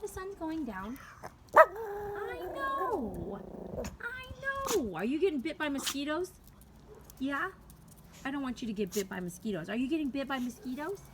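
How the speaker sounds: high, soft cooing to a dog. Real, not acted. Owner checking on her dogs at sundown, pet baby talk.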